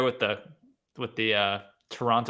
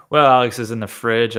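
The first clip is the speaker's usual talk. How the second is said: normal talking voice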